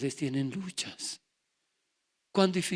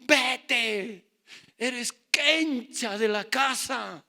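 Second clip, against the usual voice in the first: louder, slow angry casting out; retold angry parents; 7 semitones higher, 7 dB louder, much slower than usual. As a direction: throw him out of the house. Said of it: "Me dijeron".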